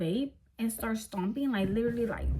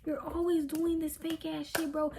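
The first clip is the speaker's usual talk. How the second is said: higher, whiny accusation, trailing softer; mock accusing boyfriend; 6 semitones higher, 3 dB quieter than usual. Boyfriend in the car accusing her, higher whiny mimic. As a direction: imitate him blaming her, voice pitched up. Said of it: "He's like".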